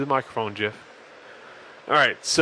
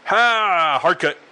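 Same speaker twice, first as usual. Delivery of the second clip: louder cue call; 5 semitones higher, 5 dB louder, much slower than usual. Real, not acted. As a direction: call the cut out loud. Calling the cut.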